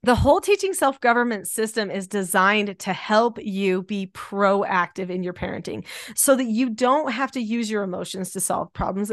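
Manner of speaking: ordinary delivery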